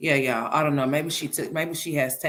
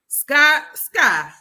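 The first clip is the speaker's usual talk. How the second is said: calling out; 3 semitones higher, 8 dB louder, much slower than usual. Real, not acted. Calling out to Sky.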